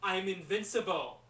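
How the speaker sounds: loud, cocky action-movie boast; arrogant movie catchphrase. Acted. Actor playing dad as arrogant climber, booming catchphrase. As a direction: belt it out like a cocky film hero. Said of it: "they gave him this catchphrase".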